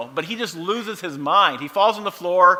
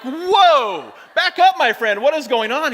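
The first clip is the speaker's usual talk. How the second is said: much higher, louder startled outburst; startled whoa; 9 semitones higher, 5 dB louder, slower than usual. Retold or imitated. Dad startled by his naked son, shouting whoa. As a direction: recoil in shock at the sight.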